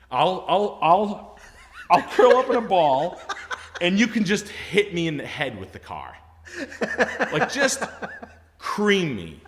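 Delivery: high, loud, half-laughing wail; mock breakdown. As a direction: plead hysterically, hammed-up for laughs. Mock breakdown begging to be run over.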